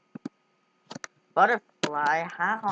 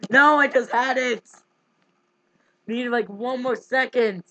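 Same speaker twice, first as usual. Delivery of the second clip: loud, high frustrated cry; frustrated outburst; 6 semitones higher, 7 dB louder than usual. Real, not acted. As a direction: yell at the near miss. Missed guess by a second.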